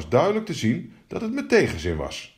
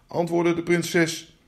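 ordinary delivery, nothing marked

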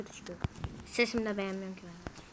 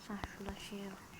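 soft unvoiced whisper; 3 semitones lower, 15 dB quieter than usual. Real, not acted. Gamer noticing a spot in the map, whispering to himself. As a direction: breathe it out like a secret to yourself. Spots something mid-game, whispers.

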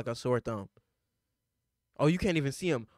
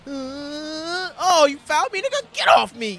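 higher, louder fake crying complaint about a foul; mock whiny player; 9 semitones higher, 9 dB louder, slower than usual. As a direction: whine like a player begging for the call. Voicing fouled player whining.

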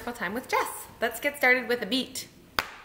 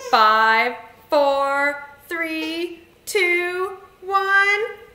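each number stepping higher; rising countdown; 5 semitones higher, 6 dB louder, much slower than usual. Shown as a demonstration. Leader counting children down to launch, rising. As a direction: build suspense number by number, climbing in pitch.

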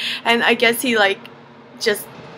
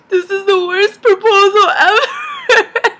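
high, loud mock-tearful wail; hammed-up sobbing complaint; semi-crying; 10 semitones higher, 6 dB louder, slower than usual. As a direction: wail it slowly through tears and laughter. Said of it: "semi-crying, I kid you not".

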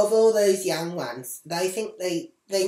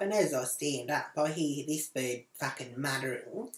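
ordinary talk, nothing marked

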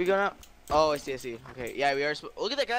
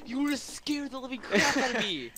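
startled; 8 semitones higher than usual. Real, not acted. Startled by a jump scare.

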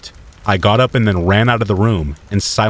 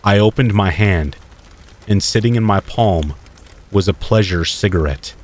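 plain reading voice

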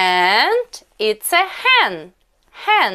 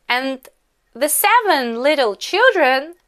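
ordinary talk

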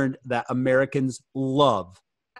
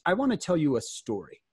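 normal talking voice

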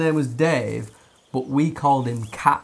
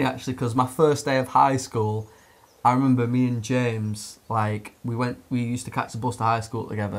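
ordinary talk, nothing marked

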